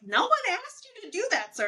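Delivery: high, sassy put-down; a dismissive, high-pitched comeback; incredulous retort. Retold or imitated. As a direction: talk back to the father, high and mocking. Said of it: "which I was like".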